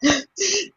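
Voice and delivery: sharp high gasp of shock; audience gasp. Retold or imitated. Audience gasping as they watch Little Fall of Rain, high gasp. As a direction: gasp like a stunned theatergoer. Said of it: "you just hear".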